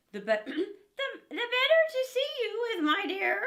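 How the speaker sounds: high, loud false granny voice; wolf in grandmother's bed answering the girl. Acted. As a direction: sweet-talk her in a fake old voice.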